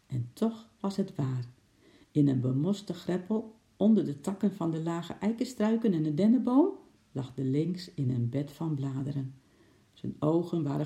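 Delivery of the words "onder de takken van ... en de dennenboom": ordinary delivery, nothing marked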